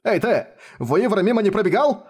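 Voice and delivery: high rough yell. A narrator's reading. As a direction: shout at the stranger. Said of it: "заорал во весь голос". (громкий окрик)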